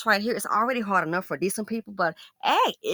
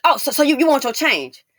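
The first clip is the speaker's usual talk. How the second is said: loud, higher mock of a snide cashier; tacky cashier; 6 semitones higher, 9 dB louder than usual. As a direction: sneer it back like she's shaming me. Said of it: "But talk real tacky to me".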